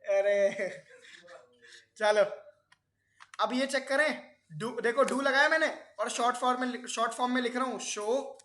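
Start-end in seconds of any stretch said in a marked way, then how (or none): 0.0-2.2 slow words broken by a real laugh. Real, not acted. Teacher laughing along with giggling students, then moving on. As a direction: give in to the laugh, then move on.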